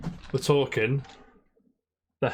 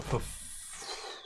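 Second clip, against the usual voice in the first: breathy cut-off swear under the breath; a whispered, stifled swear of frustration; whispered curse; 12 dB quieter than usual. Retold or imitated. Quoting his own exasperation.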